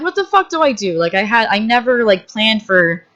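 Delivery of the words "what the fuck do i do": panicked. Retold or imitated. Panicked self-quote.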